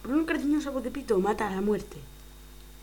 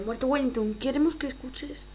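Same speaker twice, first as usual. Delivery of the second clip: normal talking voice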